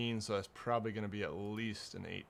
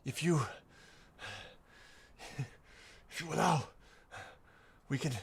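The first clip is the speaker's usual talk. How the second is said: halting, higher voice of a hurt captive; pained pleading; 4 semitones higher, faster than usual. Acted. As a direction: plead in broken pieces between pained breaths. Pained captive bargains for his life.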